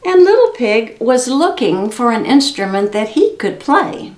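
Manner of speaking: ordinary delivery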